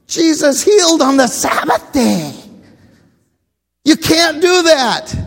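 high, loud indignant protest; outraged Pharisee. Retold or imitated. Preacher voicing Pharisees accusing Jesus, indignant shout. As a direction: scold like a scandalized rule-keeper.

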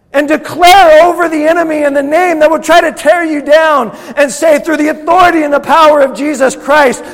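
high, intense full-force shouting; impassioned, very loud preacher's roar; angry-sounding preaching. Real, not acted. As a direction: thunder defiance at the enemy. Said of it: "I sound angry".

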